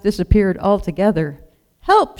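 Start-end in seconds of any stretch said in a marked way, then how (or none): 1.8-2.2 much higher, louder cry as she falls; a falling lamb's yelp; panicked cry for help; bleating for help. A narrator's reading. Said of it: "she bleated as she fell".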